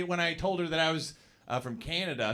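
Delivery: ordinary delivery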